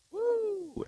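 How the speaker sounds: high excited whoop. Real, not acted. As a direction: let out a joyful woo. Cheer out on the river.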